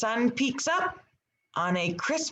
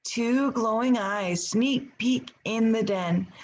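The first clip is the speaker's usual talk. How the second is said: ordinary talk, nothing marked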